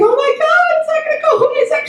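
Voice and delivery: very high frightened wail; mock a child's mounting terror; retold panic. Child trapped in car seeing mummy, high panicked wail.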